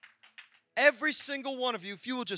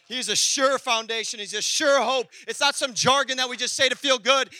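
louder, driving preacher cadence; preaching at full force; 3 semitones higher, 7 dB louder than usual. Acted. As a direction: preach it out with fire.

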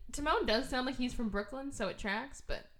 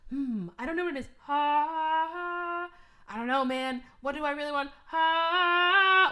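mock musing; 7 semitones higher, 7 dB louder than usual. Timon voiced thinking aloud.

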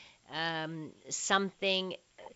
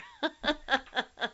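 amused laugh; 9 semitones higher than usual. Real, not acted. Laugh after reading the poem.

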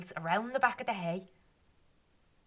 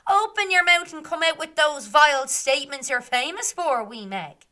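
higher and louder scolding jeer; mocking taunt; 8 semitones higher, 10 dB louder than usual. Fairy men jeering at Meg, loud and high. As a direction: jeer at her loudly.

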